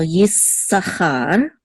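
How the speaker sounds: "Yisachar" slowly, with a long s; held S. Shown as a demonstration. Teacher modelling the doubled letter, held S. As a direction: stretch the s in "Yisachar", then stress char. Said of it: "just hold it a little bit".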